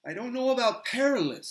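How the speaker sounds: put-on an earnest young hobbit gushing about the Lady; Sam, eager hobbit. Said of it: "said Sam".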